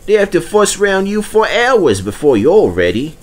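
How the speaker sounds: high, put-on boasting voice; boastful Diesel. Diesel sneering at the old steam engines, high and smug.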